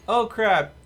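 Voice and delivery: loud, high "oh crap" at the screen; dismayed. Real, not acted. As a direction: caught off guard. Realizes gift crashed game.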